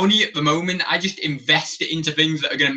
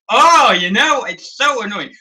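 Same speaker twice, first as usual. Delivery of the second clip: higher, louder mock annoyance; mock exasperation; 6 semitones higher, 5 dB louder than usual. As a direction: play up a fed-up parent moan. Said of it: "And I'm like".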